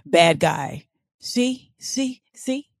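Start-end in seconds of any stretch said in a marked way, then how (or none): 1.2-2.7 higher, trailing-off mock gangster; fading gangster voice. Podcaster trying the gangster voice once more, fading. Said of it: "I think I'm doing a, a bad, like, bad guy".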